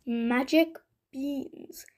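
slow, secretive reveal; mysterious. Acted. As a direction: draw out the words like a secret. Butcher reveals the beans.

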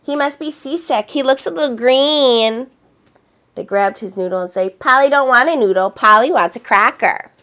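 loud mocking taunt; mock the boy; teasing. A narrator's reading. Pirates teasing poor Oliver, loud and mocking. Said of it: "teasing poor Oliver".

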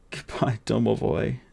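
put-on boy sobbing farewell to his friend; sobbing goodbye. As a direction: Goodbye said through tears, near breaking. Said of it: "sobbed the boy".

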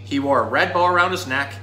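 normal talking voice

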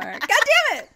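usual speaking voice

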